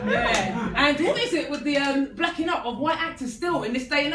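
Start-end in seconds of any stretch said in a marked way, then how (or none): none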